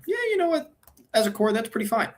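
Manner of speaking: high-pitched, mock-casual self-reassurance. Voices mock self-reassurance about roster.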